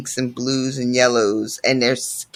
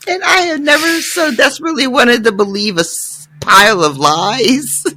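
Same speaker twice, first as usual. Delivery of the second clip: cry-laughing exasperation; 9 semitones higher than usual. Real, not acted. Reader laughing in despair at the doctor's lies. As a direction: wail it while laughing.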